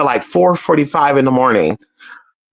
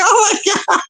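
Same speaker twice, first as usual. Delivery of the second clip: retold crying wail, way higher, half laughing; mock crying. Telling the audience she feared sleeping outside, mock crying wail. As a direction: wail it high and whiny like a hammed-up sob. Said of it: "crying and shit".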